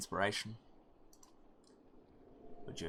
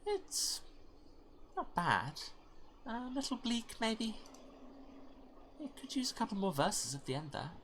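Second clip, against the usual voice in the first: higher, wry mild critique; wry higher-voiced companion; character critique voice; 12 semitones higher, 6 dB quieter than usual. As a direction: tease the poet gently. Voicing listener character.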